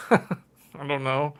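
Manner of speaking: slow, laughing through the words. Real, not acted. Puzzled by a Spanish ad, laughing. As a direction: chuckle it out, puzzled.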